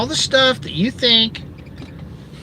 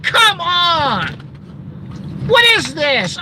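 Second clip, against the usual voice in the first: stretched, high-pitched are you kidding me; exasperated disbelief; 14 semitones higher, slower than usual. Real, not acted. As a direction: throw up your hands in disbelief. Exasperated rant at viewers.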